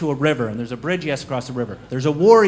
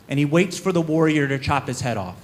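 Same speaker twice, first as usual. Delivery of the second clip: ordinary delivery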